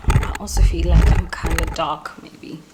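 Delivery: unvoiced muttered aside; hushed self-doubt to camera; whisper. Real, not acted.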